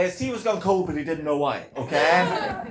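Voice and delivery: usual speaking voice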